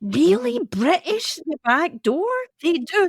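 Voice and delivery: high-pitched, astonished questioning; incredulous surprise. Real, not acted. Scot hears claim about the British, incredulous surprise. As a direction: question each bit in rising disbelief.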